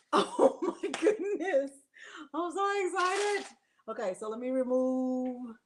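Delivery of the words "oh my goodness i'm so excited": laughing delight. Real, not acted. Delighted, laughing, near tears.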